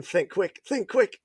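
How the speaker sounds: rising, hushed mock panic, climbing on the repeat; mild retold panic. To himself as cops pull up, lightly panicked.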